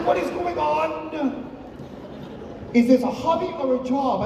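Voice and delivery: high-pitched mother demanding answers; mock an exasperated mom's questioning; comic high mother voice. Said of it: "She said".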